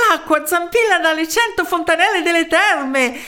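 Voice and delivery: high-pitched happy announcement; smiling excited news. A narrator's reading. Third townsman telling the mayor, smiling high voice. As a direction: deliver it high with a smile. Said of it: "disse con un sorriso".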